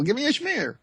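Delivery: high, pleading "Give me a schmear"; mock deli order. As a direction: order it like a customer at a deli counter, voice up. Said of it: "I want a schmear of".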